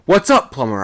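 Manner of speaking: a sailor calling up into the dark; called out. A narrator's reading. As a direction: shout up to the man above. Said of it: "I sung out".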